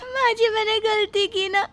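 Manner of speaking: pleading through tears; tearful, high apology to mother-in-law; tearful daughter-in-law. Acted. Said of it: "Surabhi रो रही है".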